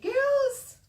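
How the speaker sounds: high, loud call upward; Sarah calling up from the backyard, high and loud. Acted. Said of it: "and said".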